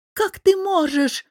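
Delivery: mother flares up in outraged reproach; flaring up. A narrator's reading. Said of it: "вспылила мама".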